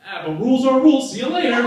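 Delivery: high, loud taunt. Retold or imitated. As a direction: brush it off loudly. Wrestler voice continues.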